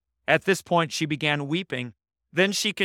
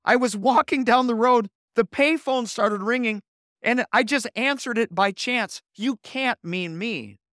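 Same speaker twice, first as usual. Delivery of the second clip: a startled man still rattled by the call; shaken and perplexed; 6 semitones higher than usual. Retold or imitated. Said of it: "Still shaken, perplexed".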